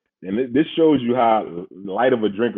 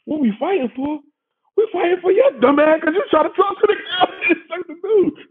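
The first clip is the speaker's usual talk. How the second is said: much higher, louder, laughing exasperation; exasperated yell; 3 dB louder than usual. Retold or imitated. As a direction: yell at your dumb friend, half laughing. Friends yelling at drunk teller.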